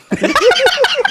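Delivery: bursts out laughing, high and loud; high cackling laugh at his own joke. Real, not acted.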